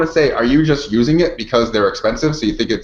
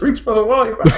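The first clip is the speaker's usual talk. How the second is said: put-on Scottish accent; 9 semitones higher than usual. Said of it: "Go into a Scottish accent".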